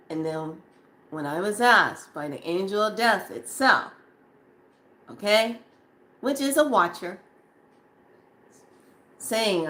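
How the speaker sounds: usual speaking voice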